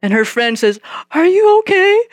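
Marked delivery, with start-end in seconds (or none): 1.1-2.0 put-on high, louder girlish "are you okay"; worried schoolgirl; girl's worried voice. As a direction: voice the anxious friend up high. Friend worried for trembling girl.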